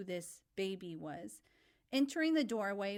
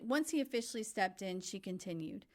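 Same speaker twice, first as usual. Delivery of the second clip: usual speaking voice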